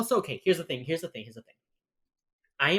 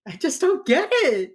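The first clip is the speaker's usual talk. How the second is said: loud, very high exasperated cry; exasperated outburst; 13 semitones higher, 9 dB louder than usual. Real, not acted. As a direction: burst out in high-pitched bafflement. Exasperation peaks after repeating complaint.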